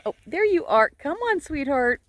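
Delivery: high, coaxing call. Real, not acted. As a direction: sweetly call the sheep over. Calling to sheep.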